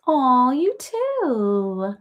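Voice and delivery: drawn-out, slow, high aw; touched aw. Real, not acted. Answering a kind viewer, slow tender aw. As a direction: melt at the compliment.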